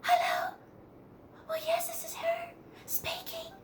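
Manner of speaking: very high, breathy, proper telephone manner; prim formal phone voice. Retold or imitated. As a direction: put on airs for a stranger calling. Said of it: "If she didn't know you".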